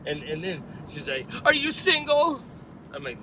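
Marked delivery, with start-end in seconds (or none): 1.5-2.4 high, loud mock-tearful question; hammed-up high female wail; imitated distraught woman. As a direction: blurt it as the crying girl, imitated. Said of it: "crying her eyes out".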